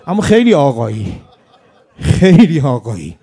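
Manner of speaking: fervent loud praise. Acted. Dying martyr praising Imam Hussein.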